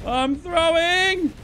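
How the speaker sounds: high stretched panic cry; drawn-out panicked yell. Real, not acted. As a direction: wail it slowly in panic.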